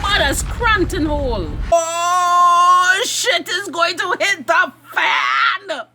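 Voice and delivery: overjoyed fangirl yell; excited scream. Real, not acted. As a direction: scream it like a thrilled fan losing it. Fan screaming at a show moment.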